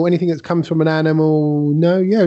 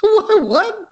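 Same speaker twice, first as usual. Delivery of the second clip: high incredulous double take; baffled, pitch shooting up; surprised. Real, not acted. Happy-feeling claim puzzles him.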